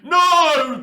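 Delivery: sudden high, loud shouted no; a loud mock-outraged no; mock scream. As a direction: yell it like an angry fan. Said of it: "if you're screaming at me saying".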